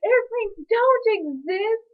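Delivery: put-on high, slow, near-shouting kid protest; a mom imitating her son insisting planes don't exist; insistent child. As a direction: drag it out like a child who won't be told otherwise. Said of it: "he's like".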